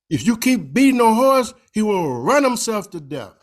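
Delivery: high, loud emotional warning; quoting the Imam's emotional emphasis; emphatic Imam warning. Retold or imitated. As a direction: urge it with passion. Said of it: "in a very emphatic and emotional way".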